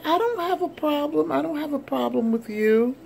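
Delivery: high-pitched defensive protest, imitating the woman; acted protest, pitched well up; defensive denial.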